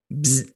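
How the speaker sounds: a small buzzing mosquito noise. Retold or imitated. Mosquito buzzing at your ear, mimicking the buzz.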